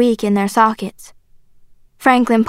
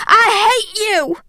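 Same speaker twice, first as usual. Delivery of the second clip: high, slow, drawn-out cry; hateful scream; 10 semitones higher, slower than usual. A narrator's reading. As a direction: scream it with restrained volume. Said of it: "I screamed".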